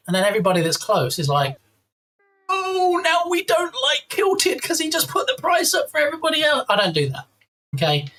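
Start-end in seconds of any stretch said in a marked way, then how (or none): 2.5-6.6 high, whiny mock complaint; whiny viewer. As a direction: pitch up and whine like an annoyed viewer. Imitating annoyed viewers complaining.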